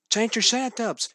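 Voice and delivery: high, fast excited shout. A narrator's reading. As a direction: yell his toy's name in relief. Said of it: "shouted Juan Pablo".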